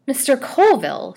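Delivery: high, loud indignant cry; angry. A narrator's reading. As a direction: cry his name in outrage. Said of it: "she cried angrily".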